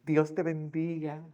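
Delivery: slow, sugary fake blessing; a sarcastic "God bless you" to a bad driver; mock blessing. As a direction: drag out a falsely pious blessing. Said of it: "¿Y qué uno dice?".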